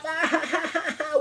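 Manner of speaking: high, staccato fake laugh; hammed-up jeering cackle; mock laughter. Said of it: "they're laughing".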